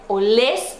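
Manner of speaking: slow "olés", rising on "lés"; "olés" with a strong, lifted last syllable; stress on final syllable. Shown as a demonstration. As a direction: put the weight on the end of "olés". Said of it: "sílaba tônica no final".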